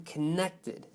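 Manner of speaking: CONNECTED slowed and lifted, stressed on the middle syllable; emphasized CONNECTED. Shown as a demonstration. Teacher showing students emotional connection, emphatic CONNECTED. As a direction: stretch out CONNECTED with deliberate weight. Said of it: "I emphasized it".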